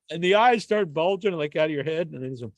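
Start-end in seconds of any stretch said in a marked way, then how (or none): none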